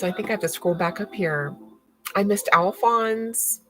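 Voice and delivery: ordinary talk, nothing marked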